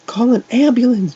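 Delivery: very high, loud scream for help. A narrator's reading. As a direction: scream it desperately. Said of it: "Jeff screamed".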